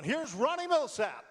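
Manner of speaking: imitated emcee calling out the act; show host announcing. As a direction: announce the star to a cheering crowd. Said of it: "The host comes on".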